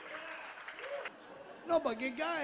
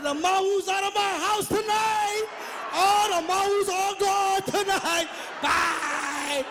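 loud, gleeful send-off, higher and louder; gleeful shout; 5 semitones higher, 5 dB louder, slower than usual. Retold or imitated. Father gleefully shouting his sons out of the house. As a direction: cheer them out the door. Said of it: "I was like".

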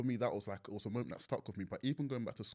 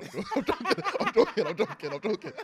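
high, loud, laughing I'm joking; laughing backpedal; 11 semitones higher, 10 dB louder than usual. Retold or imitated. Man laughing as he backpedals to a girl in a pub. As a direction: scramble to take it back, laughing.

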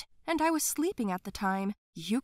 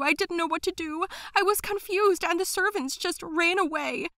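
higher, breathless and panicked; frightened nanny; 8 semitones higher, 5 dB louder than usual. Acted. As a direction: spill it all out in a panic. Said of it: "barely able to catch her breath".

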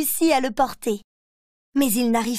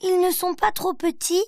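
a small worried bear asks; child voice unsure of its slippers; little bear asking; 6 semitones higher than usual. A narrator's reading. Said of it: "Il demande".

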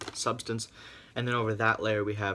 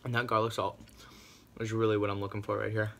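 chewing while talking; words between bites of food; mouth partly full. Real, not acted. Seen 1: bit at -3, lips and cheeks work while speaking.

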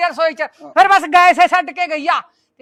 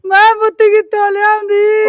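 wailing lament; 3 semitones higher, 8 dB louder than usual. Acted. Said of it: "ਤੂੰ ਰੋ ਨਾ".

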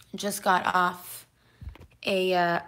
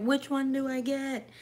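voicing the dog; 6 semitones higher than usual. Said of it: "You're like".

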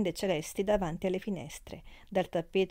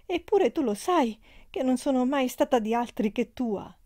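raised, pleading, falling; pleading reproach; 5 semitones higher, 4 dB louder than usual. A narrator's reading. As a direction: plead her faithfulness. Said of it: "in atto di amore e di rimprovero". (supplica piena d'amore)

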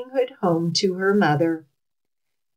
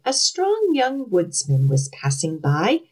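ordinary delivery

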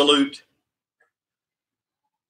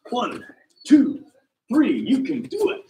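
box over head; 7 semitones higher than usual. Real, not acted. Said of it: "I'm gonna do it again just to inspire you".

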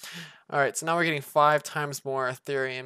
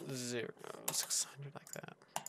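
whisper; 12 dB quieter, slower than usual. Real, not acted. Whispered aside while typing.

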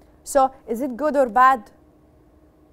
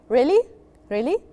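questioning really. Shown as a demonstration. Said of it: "when you are thinking".

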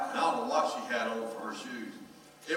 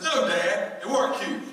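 exasperated son; 6 semitones higher, 8 dB louder than usual. Retold or imitated. Son retorts.

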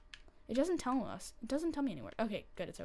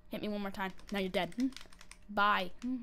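ordinary talk, nothing marked

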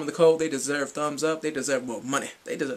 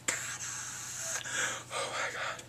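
whisper; 8 dB quieter, much slower than usual. Real, not acted. Hushed swooning over idols.

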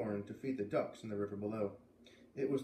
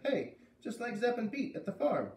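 put-on kid's voice, high and lively; boy's voice; 10 semitones higher than usual. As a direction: answer like a busy young boy. Luke exclaims.

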